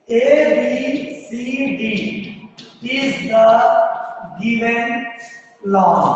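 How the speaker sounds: measured pace for students writing it down; slow dictation. Real, not acted. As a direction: dictate word by word with long pauses. Teacher dictating a line to write. (धीरे-धीरे रुक-रुक कर लिखवाते हुए)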